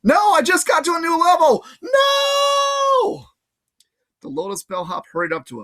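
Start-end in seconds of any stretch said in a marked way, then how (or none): 0.0-3.3 very high, loud protest; shout. Acted. Grover protesting being dragged from the game, high and loud. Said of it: "as he shouted".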